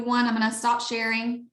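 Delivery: ordinary delivery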